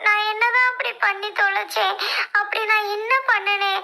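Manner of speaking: high, sobbing lament; crying out a woeful complaint; tearful wailing. Acted. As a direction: wail self-pityingly through sobs. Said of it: "இவ்ளோ அழுகலடா".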